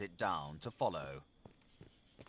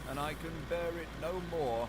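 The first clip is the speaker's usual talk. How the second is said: dogged; 7 semitones higher than usual. A narrator's reading. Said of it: "doggedly disregarding the question".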